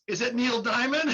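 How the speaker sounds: sarcastic retort; mocking. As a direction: toss the question back with a sneer. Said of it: "So he's mocking me".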